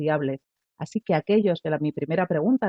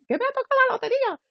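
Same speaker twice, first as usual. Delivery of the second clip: much higher, fast, thrilled cry; shouted joy; faster than usual. Shown as a demonstration. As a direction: blurt it out, bursting with joy. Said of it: "a gritos, como dice María".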